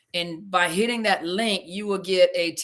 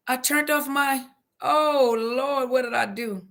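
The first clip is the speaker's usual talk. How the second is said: higher-pitched, dismayed realization; real dismay; 5 semitones higher, slower than usual. Real, not acted. Just cut off the stream.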